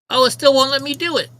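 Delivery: loud, high real exasperation; frustrated outburst. Real, not acted. Streamer blocked from sharing campaign, loud frustrated complaint. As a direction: burst out when the thing still fails.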